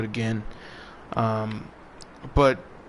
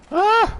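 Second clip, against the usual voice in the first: sudden high yelp of surprise; a quick startled cry, much higher; real startled shout; 5 dB louder than usual. Real, not acted. Startled while climbing in game.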